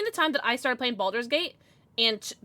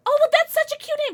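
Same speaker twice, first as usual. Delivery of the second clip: very high, louder gushing; gushing delight; 7 dB louder than usual. Real, not acted. Streamer gushing to a viewer about their name, delighted. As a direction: coo over how cute it is.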